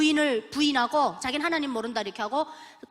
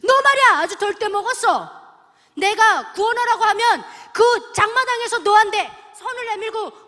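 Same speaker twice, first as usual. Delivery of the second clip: stern rebuke; 10 semitones higher, 9 dB louder than usual. Retold or imitated. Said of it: "엄청 혼내셨어요".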